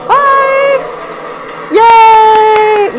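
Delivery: loud happy cheer; excited cheering. Real, not acted. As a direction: shout for joy. Cheering stage separation.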